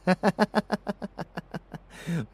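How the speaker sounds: high, rattling cackle; menacing cackle. Acted. Villain warning lover they cannot hide, high cackle. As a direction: cackle after a playful threat.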